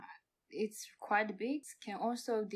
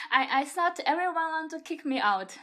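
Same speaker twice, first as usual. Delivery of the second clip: fast, louder, through laughter; laughing confession; 6 semitones higher, 9 dB louder, faster than usual. Real, not acted. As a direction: admit it while still laughing.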